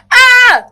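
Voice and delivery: short, loud, high shriek; high scream. Real, not acted. Reader reacting to a steamy line, high shriek. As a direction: let out a sudden squeal. Exclaims during steamy reading.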